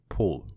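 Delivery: "Paul" said like "Pole"; his Czech pronunciation. Retold or imitated. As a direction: say "Paul" with a long O, as he does. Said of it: "saying his name the way he said".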